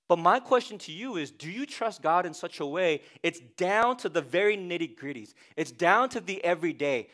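normal talking voice

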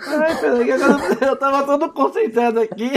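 breathless giggling, high-pitched; after laughing hard. Real, not acted. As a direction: still laughing, catching breath. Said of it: "Deixa eu respirar".

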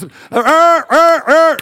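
loud, high walrus-like grunting; walrus grunts. Retold or imitated. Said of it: "I sound like a walrus".

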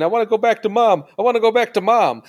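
high, whiny plea for mom; tearful kid. Retold or imitated. Dad imitating his tearful kid in the car, high and whiny. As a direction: imitate a crying child wanting mom. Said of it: "a kid with tears and tears next to me".

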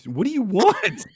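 high, loud exasperated question; incredulous outburst. Real, not acted. Friend scoffing at the customer's contradictory demands, high and loud. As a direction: throw up your hands in disbelief. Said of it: "friend mocking customer's demand".

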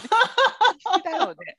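high, slow words through laughter; laughing. Real, not acted. Friend laughs picturing a tiny clinging man. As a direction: stretch it out while cracking up.

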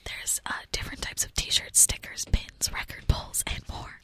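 whispered sponsor read; ASMR ad read. Pretend. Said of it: "live read in A S M R".

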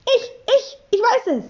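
sehr hohes, drängelndes Melden; eifriger Schüler. A narrator's reading. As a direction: eifrig und hoch dazwischenrufen, ohne lauter zu werden. (aufgeregtes Kind meldet sich)

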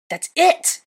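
high, loud snapping cry; outburst. A narrator's reading. Hermione losing her temper at Draco. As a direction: break at last and shout it. Hermione snaps during the quarrel.

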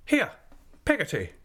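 high, commanding call; mild imperious cry. Acted. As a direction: summon the servant briskly. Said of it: "cried Miss Betsy, opening the parlour door".

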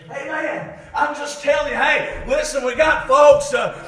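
full-voiced, driving sermon delivery; impassioned preaching. Real, not acted. Preacher urging the church to be ashamed of sin, impassioned preaching. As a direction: preach it hard to the congregation.

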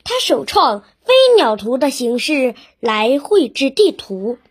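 usual speaking voice

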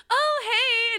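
high bright oh hey; chirpy greeting. Retold or imitated. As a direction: sing it out high and friendly. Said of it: "she was like".

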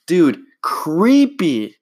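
laughing. Real, not acted. Laughing at his reading.

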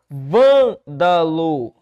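"Vândalo" slowly as VÂN-da-lo; syllable split. Shown as a demonstration. Teacher locating the stressed syllable, "Vândalo" split VÂN-da-lo. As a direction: hit a long loud first syllable in "Vândalo", separate the rest. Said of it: "O que eu tenho é a sílaba tônica bem aqui".

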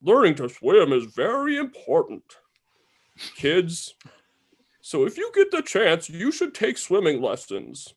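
put-on high, chirpy kids' TV host; PSA presenter voice. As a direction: sell it like a cheesy public service ad. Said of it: "is like".

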